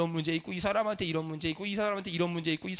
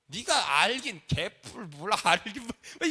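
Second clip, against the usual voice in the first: slow, scornful mock grumble; acted exasperated dismissal; irritated retort; much slower than usual. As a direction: stretch it out like a fed-up parent. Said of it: "성질만 나요".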